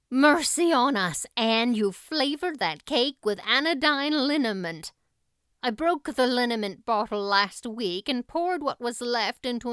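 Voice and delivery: put-on loud, dismayed older woman; alarmed scolding, then rueful; exasperated Marilla. Marilla discovers the liniment.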